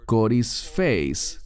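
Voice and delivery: "corisfeis" said slowly, syllable by syllable; "corisfeis" pronounced slowly with a falling end; Greek word, carefully. Shown as a demonstration. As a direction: spell out "corisfeis" slowly for the class. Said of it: "es la palabra".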